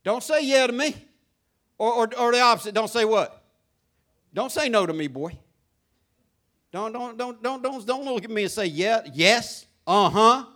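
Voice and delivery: firm, loud scolding of a son; stern father. Retold or imitated. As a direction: lay down the law to a boy. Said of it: "I say".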